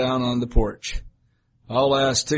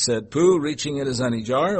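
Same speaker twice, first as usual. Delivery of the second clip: ordinary delivery, nothing marked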